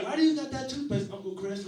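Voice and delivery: high, loud mock child question; teasing little niece. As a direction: imitate a small girl needling her uncle. Nieces teasing uncle, imitated.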